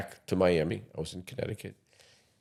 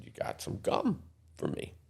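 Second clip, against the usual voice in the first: whisper; 12 semitones higher, 11 dB quieter than usual. Retold or imitated.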